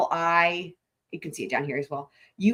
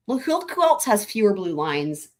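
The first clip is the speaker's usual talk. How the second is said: ordinary talk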